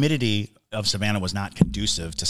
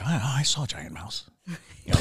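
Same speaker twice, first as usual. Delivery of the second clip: whispering; 2 semitones higher, 4 dB quieter than usual. Retold or imitated. Mock ghost witness.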